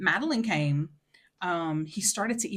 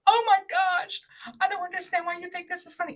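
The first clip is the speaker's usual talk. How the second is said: exasperated; 12 semitones higher, 6 dB louder than usual. Retold or imitated. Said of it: "I'm like".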